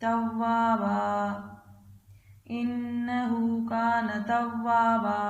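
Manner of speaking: slow, drawn-out tajweed chant, phrase by phrase; Quran recitation. Acted. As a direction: chant each phrase slowly for the child to repeat. Teacher recites Surah Nasr again.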